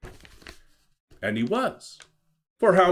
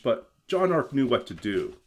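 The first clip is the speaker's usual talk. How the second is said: usual speaking voice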